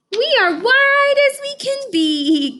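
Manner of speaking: high, slow giggly bunny voice; giggly bunnies. Acted. Bunnies boasting to the class, high giggly voice. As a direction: squeak it like giggling little bunnies. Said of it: "giggled the bunnies".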